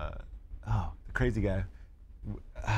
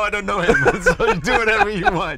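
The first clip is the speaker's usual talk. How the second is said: high, loud joke through laughter; joking that Rampage can kill the guy, laughing and high; laughing mock; 11 semitones higher, 9 dB louder than usual. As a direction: disown him while cracking up.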